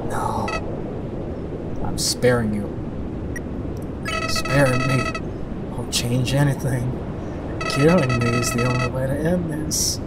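low, bitter villain voice; dark, resigned menace; defeated villain. Acted. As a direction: speak low and spent, daring them to finish it.

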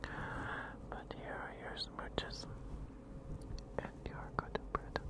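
soft close whisper to viewers; ASMR whisper. Acted. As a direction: breathe each word as a tender whisper.

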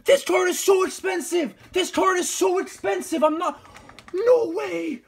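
screaming, high and loud; raging gamer. Real, not acted. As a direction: lose it over an expensive card. Pulls an expensive card.

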